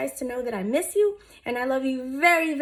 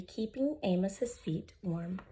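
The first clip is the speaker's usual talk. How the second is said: plain reading voice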